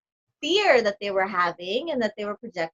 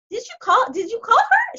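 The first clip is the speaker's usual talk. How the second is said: much higher and a bit louder, pressing; urgent self-quote; 11 semitones higher, 5 dB louder than usual. Retold or imitated. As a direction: push the assistant, pitch way up. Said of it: "I'm like".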